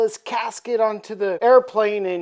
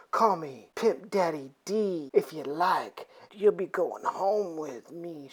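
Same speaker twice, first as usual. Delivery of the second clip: low, soft seducer; suave Dracula; 4 semitones lower, 8 dB quieter than usual. Acted. Dracula luring Ellie home, low suave murmur. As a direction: drop low and quiet, seductively. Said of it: "He says".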